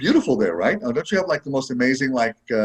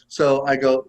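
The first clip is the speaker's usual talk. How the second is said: ordinary talk, nothing marked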